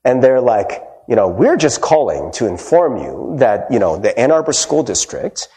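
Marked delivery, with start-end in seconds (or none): 1.3-5.5 lower, lightly voiced official phone announcement; pretend school caller. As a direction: imitate a school office notice, understated. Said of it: "they pretended to be the kid's school".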